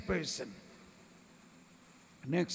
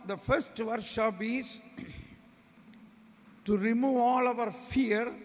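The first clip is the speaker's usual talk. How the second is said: ordinary talk, nothing marked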